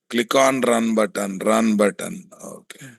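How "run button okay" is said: ordinary talk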